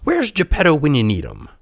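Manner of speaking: high, grumbling puppet voice; acted petulant whine; huffy. A narrator's reading. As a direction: complain like a put-out child. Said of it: "huffed Pinocchio".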